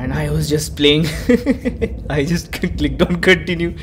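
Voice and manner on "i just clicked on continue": laughing at himself. Real, not acted. Laughs at own mistake.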